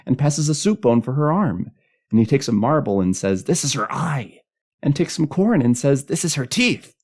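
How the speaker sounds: put-on high, loud child rattling on gleefully; happy, excited child. A narrator's reading. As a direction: show off like a kid who knows the game. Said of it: "some child cried happily in the dark".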